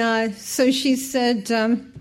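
normal talking voice

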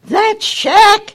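high, loud, outraged yell; angry shout. Retold or imitated. Contractor scoffing at the house, loud angry yell. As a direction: blurt it out in disgust. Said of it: "He was angry".

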